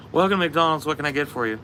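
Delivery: high-pitched service greeting; mock fast-food order-taker; imitated drive-thru worker.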